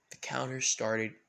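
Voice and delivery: plain reading voice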